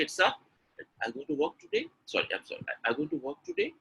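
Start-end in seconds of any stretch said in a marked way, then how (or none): 0.3-1.9 high-pitched question, softer, rising on "today"; question intonation. Shown as a demonstration. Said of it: "That becomes a question". 2.8-3.7 high-pitched question, softer, rising on "today"; question intonation. Shown as a demonstration. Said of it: "That becomes a question".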